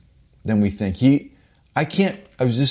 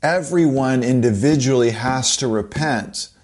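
ordinary talk

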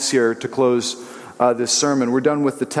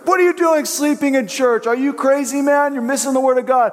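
fake-yell at the sleepy kid; mock outrage; 10 semitones higher than usual. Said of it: "I'd look at them".

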